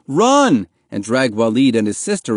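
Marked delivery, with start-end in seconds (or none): 0.1-0.7 high, loud warning shout; sudden high shout of alarm; urgent yell. A narrator's reading. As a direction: yell it with no time to spare. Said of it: "barely had time to yell".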